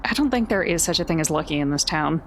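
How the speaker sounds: ordinary talk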